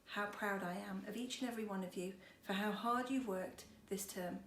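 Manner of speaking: ordinary delivery, nothing marked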